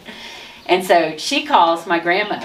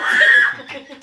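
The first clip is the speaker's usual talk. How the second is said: laughter; 7 semitones higher than usual. Real, not acted. After the mom impression.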